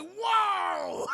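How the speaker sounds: high, loud shocked "Whoa!"; startled whoa. Retold or imitated. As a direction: jump back with a yelp. Retold startled reaction.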